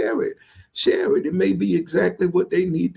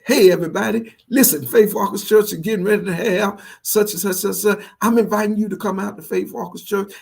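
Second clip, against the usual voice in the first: upbeat, higher-pitched radio promo read; high energy; 6 semitones higher, faster than usual. Retold or imitated. Pastor re-recording his church radio spot with a smile, high energy. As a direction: sell it with a bright burst of energy, pitched up. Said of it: "Now say it with energy".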